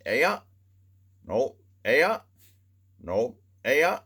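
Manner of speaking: a deadpan local's back-and-forth, "Aya" up and "No" down; mock Mainer answering. Mainer girl blinking the blinker.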